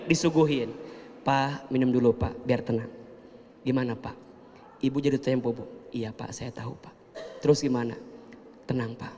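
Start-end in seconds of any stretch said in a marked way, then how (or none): 1.3-9.1 softer, unhurried soothing replies; calm, soft. Retold or imitated. Wife calmly serving the debt collector, soft and unhurried. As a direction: keep it gentle and calm.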